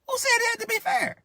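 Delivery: preacher throwing the whiner's words back; mocking high retort.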